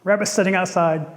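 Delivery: usual speaking voice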